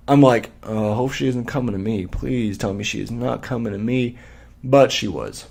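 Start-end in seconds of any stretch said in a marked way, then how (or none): 0.6-4.2 quiet, worried self-talk under the breath; hushed inner plea. Retold or imitated. Muttering to himself as the angry mom approaches, hushed. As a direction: plead softly to yourself. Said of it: "saying to myself".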